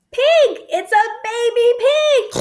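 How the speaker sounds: high, loud call of the animal name; bright excited shout of the name; excited announcement. Acted. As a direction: call it out for the kids. Announcing the sound button.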